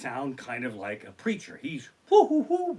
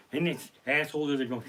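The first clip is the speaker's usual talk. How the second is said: ordinary talk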